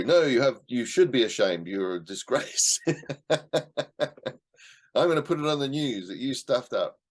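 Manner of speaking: mock scolding. Imitating someone shaming a person who stuffed up, mock scolding. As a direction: play a scolder shaming someone, half laughing.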